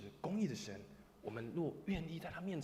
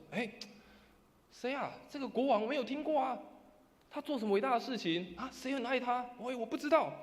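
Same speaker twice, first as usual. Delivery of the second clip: high, raised, dismissive voice; somewhat louder; 8 semitones higher, 4 dB louder than usual. Acted. Person inside refusing the unknown king, somewhat louder. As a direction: turn them away in a louder voice. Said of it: "稍微大声的声音说什么".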